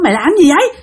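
high, rapid-fire mock telling-off; furious scolding. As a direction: act out a horrified mother berating her daughter. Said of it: "mẹ chửi quá trời luôn đó".

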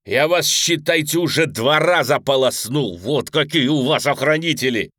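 loud jeering taunt; taunting shout. Acted. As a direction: shout the taunt in their faces. Prisoner taunting his guards.

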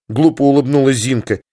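ordinary delivery

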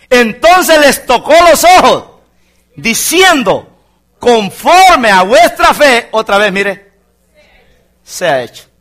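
slow ringing sermon voice; preaching at full force. Acted. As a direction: proclaim it to the congregation.

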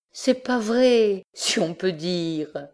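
voix de femme légèrement plus aiguë, lente; Germaine qui dément. Acted. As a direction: nie en haussant les épaules, lentement. Germaine haussait les épaules. (Germaine dément, voix de femme lente et aiguë)

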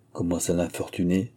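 ordinary delivery

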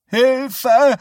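high, loud shriek; calling for help. Acted. Woman calling up the stairs, shrill cry for help. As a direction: scream for help from downstairs. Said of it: "erklang Misses Dodds Stimme von unten".

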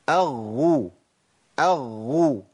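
أغو with a pressed, voiced double gh; doubled ghayn. Shown as a demonstration. Teacher showing doubled ghayn with damma, carefully articulated. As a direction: hold back breath on the long gh of أغو. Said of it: "أضغط على مخرج الحرف وأمنع عنه النفس".

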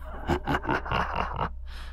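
breathless eerie cackle; ghostly cackle. Acted. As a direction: cackle like an evil spirit. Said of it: "cười sằng sặc mà rít lên".

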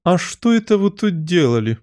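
high, demanding question; imperious Queen. A narrator's reading. Queen eyeing the rosebush questions gardeners, imperiously. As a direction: interrogate them as the haughty Queen.

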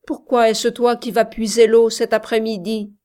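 loud, quick cry with a touch of anger; angry call. A narrator's reading. Shepherd calls to the little sister coming for water, angry call. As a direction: call out the question crossly and fast. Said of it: "lui cria-t-il avec une sorte de colère".

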